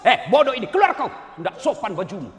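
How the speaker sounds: loud mock rebuke; harsh scolding. Imagined rude teacher yelling at a student, loud mock anger. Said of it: "mana Ustadz ngomong kasar begitu".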